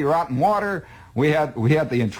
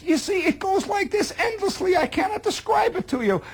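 exasperated outburst; 13 semitones higher than usual. Real, not acted. Exasperated outburst about endless marching.

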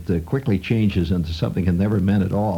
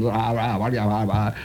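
imitating background news drone. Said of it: "reduced to the status of background music".

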